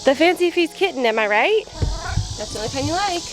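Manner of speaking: high teasing to the picky cat; pet talk. Real, not acted.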